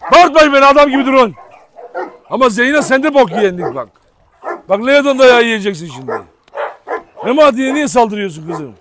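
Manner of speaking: loud, high angry scolding; scolding dogs. Real, not acted. Owner scolding dogs after the fight, loud and angry. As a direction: tell the dogs off hard.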